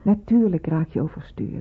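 put-on slow, hushed whisper to calm a child. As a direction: soothe her gently, barely voiced. Nurse comforting upset girl.

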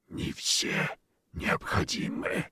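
slow whispered creature voice; gurgling alien voice. Acted. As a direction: breathe it like a hungry beast. Monster voice continues.

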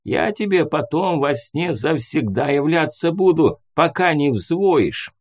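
spooky threat from a cranky ghost; grumpy old ghost. A narrator's reading.

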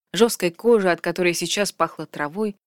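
usual speaking voice

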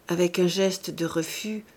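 plain reading voice

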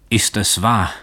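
rising to a cry on möglich; shouting. A narrator's reading. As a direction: cry out in disbelief. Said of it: "schrie er".

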